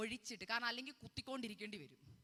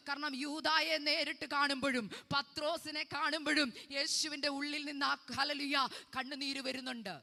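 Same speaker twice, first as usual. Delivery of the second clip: louder, raised pleading prayer-sermon; fervent preaching; 4 semitones higher, 7 dB louder than usual. Acted. Preacher voicing a tearful servant's prayer, fervent pleading. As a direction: plead with the Lord through the preaching.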